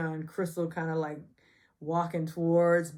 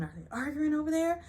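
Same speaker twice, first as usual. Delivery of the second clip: gossipy onlookers; 10 semitones higher than usual. Retold or imitated. Other girls gossiping.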